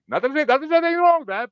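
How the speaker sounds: high, flustered not-that-there's-anything-wrong; hasty disclaimer. Retold or imitated. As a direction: rush to cover yourself. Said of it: "I'm like".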